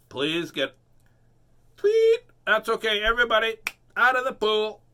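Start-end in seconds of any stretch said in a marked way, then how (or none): 1.8-2.2 high, loud whistle noise; whistle tweet. Pretend. Playing lifeguard at the pool, sharp whistle. 2.4-4.8 high, loud lifeguard shout; lifeguard call. Pretend. Playing lifeguard, loud call to swimmers.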